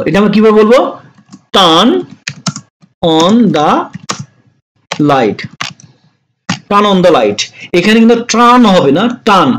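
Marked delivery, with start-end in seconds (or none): 1.6-5.4 slow dictation, with long gaps between words. Shown as a demonstration. Teacher to students, slow word-by-word dictation. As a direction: spell it out one word at a time. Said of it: "teacher breaks sentence into words".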